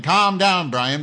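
shouting the line back at the viewer Brian; near shout. Real, not acted. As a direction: yell it at him this time. Repeats it, louder.

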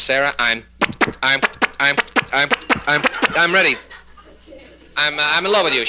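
halting stammer, stuck then bursting through; stuttering husband. Acted. Husband to his wife on honeymoon, stuttering. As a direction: catch and repeat on "I'm". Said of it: "a little impediment in his speech".

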